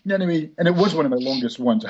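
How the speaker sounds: ordinary delivery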